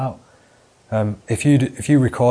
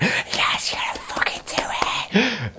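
hushed, thrilled whisper; excited whisper; 11 semitones higher than usual. Retold or imitated. Friends watching him finally strip, excited whisper. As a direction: whisper it in disbelieving glee.

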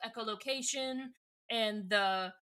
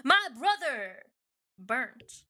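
slam poet; 3 semitones higher, 4 dB louder, slower than usual. Acted. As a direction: perform it like overwrought open-mic poetry, with weighty pauses. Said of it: "gives me slam poet".

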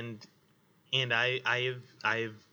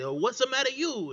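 put-on Italian accent; 10 semitones higher than usual. DM showing friends his merman voice, hammed-up Italian accent. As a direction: throw out a hammed-up Italian mobster line. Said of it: "some accents".

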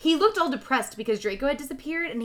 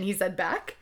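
usual speaking voice

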